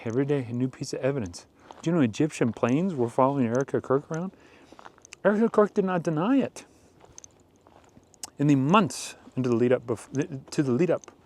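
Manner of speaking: normal talking voice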